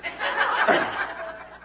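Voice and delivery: breathy chuckle at his own joke; short real laugh. Real, not acted. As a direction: laugh along with the room. Speaker laughs at own punchline.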